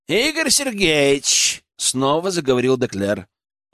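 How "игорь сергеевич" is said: high, slow, drawn-out address; haughty envoy. A narrator's reading. Деклер addresses Игорь again, haughty. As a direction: stretch the name, pitched up.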